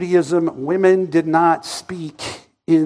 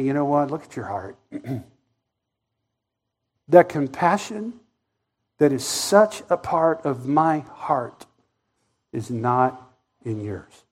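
soft voice of Jesus; 3 semitones lower, 4 dB quieter than usual. Retold or imitated. Jesus voiced rebuking the preacher.

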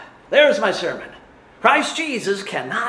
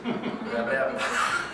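breathy laugh; 8 dB quieter than usual. Real, not acted. Laughing at his stumble.

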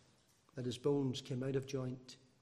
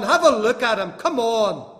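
much higher, much louder anguished shout; imagined Pilate pleading; 8 semitones higher, 16 dB louder than usual. Retold or imitated. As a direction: Pilate crying out to the crowd in exasperation. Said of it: "he was saying something like this".

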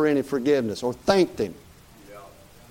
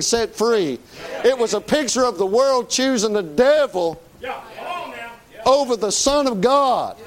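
forceful preaching; 7 semitones higher than usual. Real, not acted. Preacher correcting a congregation about Barabbas, forceful preaching. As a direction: preach it hard with long held words.

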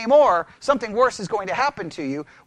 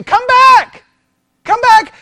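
high, loud, pleading mock shout; hammed-up desperate shout after a departing man; mock calling out; 12 semitones higher, 11 dB louder, slower than usual. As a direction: call after someone walking away, hammed-up.

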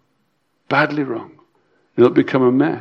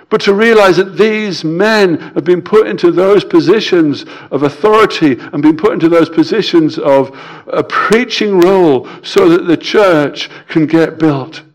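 ordinary talk, nothing marked

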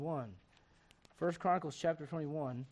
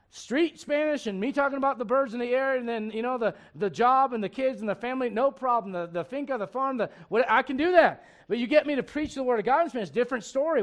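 louder, higher, driving sermon delivery; fervent preaching; 5 semitones higher, 8 dB louder than usual. Acted. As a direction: preach it with rising fire. Preaching fervently about Spanish.

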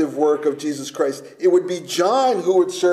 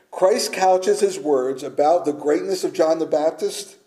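ordinary talk

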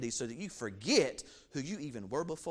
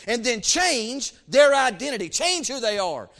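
loud, higher fervent sermon climax; preaching shout; 5 semitones higher, 8 dB louder than usual. Real, not acted. Preacher warning of lost identity, rising loud preaching. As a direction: drive it home to the pews.

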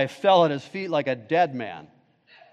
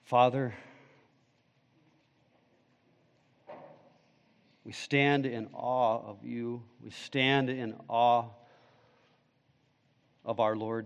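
prayer; 6 semitones lower, 10 dB quieter than usual. Acted. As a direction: pray quietly before God. Pastor leads closing prayer.

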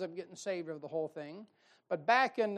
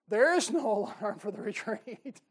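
bugler's answer laughed out, louder; laughing punchline; 3 semitones higher, 7 dB louder than usual. Retold or imitated. Bugler answering the general, laughing loudly. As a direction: crack up through the line. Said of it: "he looks at the general and goes".

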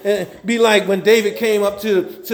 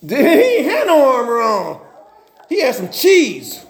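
ordinary delivery

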